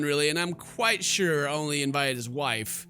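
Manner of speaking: normal talking voice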